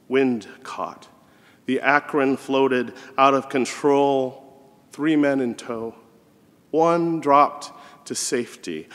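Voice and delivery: measured poem recital with deliberate pauses; slow, spaced-out poem reading; poetry reading. Acted. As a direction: read it as verse, slowly, letting each phrase hang. Reads a poem aloud.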